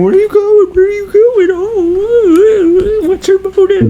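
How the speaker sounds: very high, loud squeaky sobbing; shrill mock cry; mock wailing. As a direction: wail like a hammed-up horror heroine. Acting the tormented heroine wailing.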